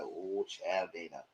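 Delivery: low, dry aside; weary oh child. Retold or imitated. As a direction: sigh it out like an auntie shaking her head. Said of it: "I was like".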